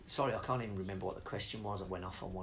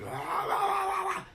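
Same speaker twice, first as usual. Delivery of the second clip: high, loud imitated metal scream; mock metal scream; 8 dB louder than usual. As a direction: belt out a screaming metal singer, tongue in cheek. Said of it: "He shouts and screams".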